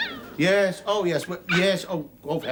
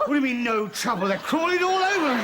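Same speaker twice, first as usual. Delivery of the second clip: indignant shout climbing high; frantic protest; 4 semitones higher than usual. Acted. Husband crawling with ants to his wife, frantic protest. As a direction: climb into a panicky, outraged wail.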